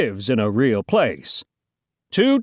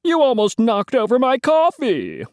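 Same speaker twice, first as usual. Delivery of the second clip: high-pitched character grumbling; indignant complaint; 11 semitones higher than usual. Acted. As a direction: protest in a raised pitch. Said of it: "complained the other reporter".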